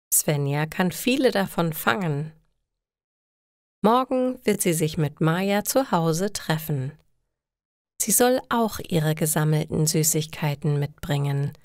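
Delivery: ordinary delivery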